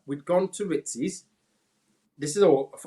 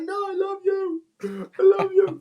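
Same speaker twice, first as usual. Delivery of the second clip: high, slow, teary insistence on love; begging mum to hear it through tears; drunken tearful pleading; 4 dB louder, slower than usual. Retold or imitated. Drunk crying self insisting.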